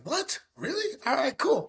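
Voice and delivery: stunned, then pleased, high voice; surprised disbelief. Retold or imitated. Retelling hearing his velocity.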